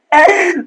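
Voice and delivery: short high chuckle; brief laugh at your own joke; quick, high-pitched giggle; amused chuckle. Real, not acted.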